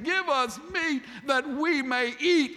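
high, slow, whiny demand; whining crowd. Acted. The complaining people demanding meat from Moses, whiny and high. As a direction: whine it like a spoiled crowd.

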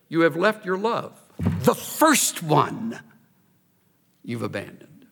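"The first one" drawn out very slowly; heavy deliberate weight on "first"; stress on first. Shown as a demonstration. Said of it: "when you want to emphasize the adjective".

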